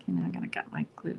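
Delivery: quick whispered aside; fast whisper to herself; hushed muttered aside. Real, not acted. Whispered aside while crafting.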